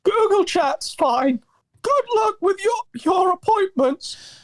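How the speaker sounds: high, thin put-on shaky voice; shaky Josh voice. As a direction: read it as a wavering, fragile Josh. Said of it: "you do a better shaky Josh voice".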